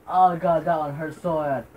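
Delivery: usual speaking voice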